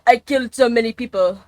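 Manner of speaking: put-on a light French accent for a cocky character; half-hearted French accent, cocky. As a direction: toss it off in a mock French accent. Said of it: "I don't know why I use a French accent".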